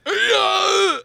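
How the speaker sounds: high, strained leap grunt; hammed-up shout as if jumping over something; mock action-hero exertion cry; jumping effort yell. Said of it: "Now jump over something".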